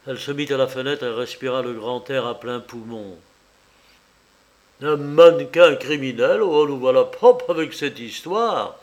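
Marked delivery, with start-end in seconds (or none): 4.8-8.8 loud gruff grumble; grumbling commissioner. Acted. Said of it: "grogna le commissaire".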